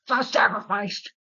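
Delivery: high, drawn-out outraged plea; indignant protest. Retold or imitated. Norman protesting to the board that fires him, indignant. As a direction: protest with mock outrage, pitched well up.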